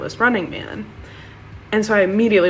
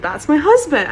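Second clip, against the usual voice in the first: high, loud gushing declaration; breathless-with-news excitement, pitched up; excited announcement; 7 semitones higher, 5 dB louder than usual. Retold or imitated. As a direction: announce it to your best friend, thrilled. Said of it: "I said".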